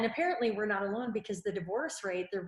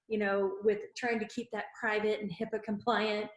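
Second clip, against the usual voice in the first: ordinary delivery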